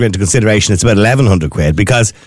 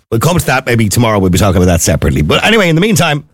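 ordinary talk, nothing marked

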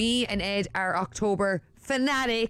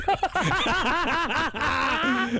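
laughing; 7 semitones higher than usual. Real, not acted. Laughs at idea.

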